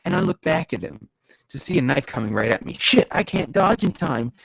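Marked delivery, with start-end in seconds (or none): 2.8-4.3 slow, high cry of alarm; drawn-out, pitched-up exclamation; slow motion. A narrator's reading. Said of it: "In slow motion, I mutter".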